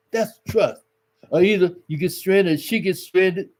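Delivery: ordinary talk, nothing marked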